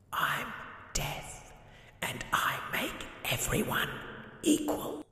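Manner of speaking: eerie whisper; Death. Acted. Death naming himself to the poor man, whispered. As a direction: breathe it out like Death itself.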